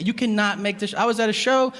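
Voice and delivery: ordinary delivery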